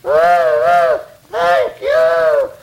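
little engine calling out loud; loud cheerful engine voice; eager little engine. Acted. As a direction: shout it out happily, ready to go. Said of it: "he says".

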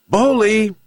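high-pitched call to his dog; old man hailing his pet. A narrator's reading. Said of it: "shouted the old man to his dog".

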